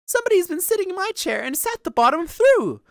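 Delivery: high, tiny bear voice; loud little high-pitched wail; wee little bear. A narrator's reading. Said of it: "in his wee little voice".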